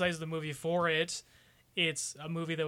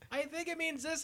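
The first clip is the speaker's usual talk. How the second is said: higher and softer quoted voice; high, soft voice for Rachel; 10 semitones higher, 6 dB quieter than usual. Retold or imitated. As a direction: pitch it up and soften it. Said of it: "she's like".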